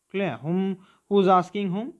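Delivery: normal talking voice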